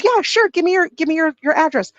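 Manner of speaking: high, eager reply in a lady's voice; helpful lady. Retold or imitated. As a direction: imitate an obliging stranger, pitched well up.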